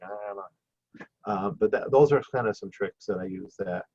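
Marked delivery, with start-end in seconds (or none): 0.0-1.0 reluctant mumble. Retold or imitated. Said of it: "might be like".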